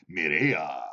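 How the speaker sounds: very low, drawn-out Spanish announcer voice. Acted. Imitating Spanish channel hosts to the chat, low announcer accent. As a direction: drop deep for a Spanish TV accent. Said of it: "they talk like, they get low".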